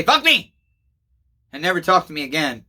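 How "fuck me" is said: sudden high yelled curse, imitating the son; mock outburst. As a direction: blurt it out as a hurt, angry kid would. Son imagined cursing father off.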